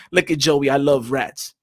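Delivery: ordinary talk, nothing marked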